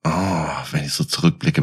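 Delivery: ordinary delivery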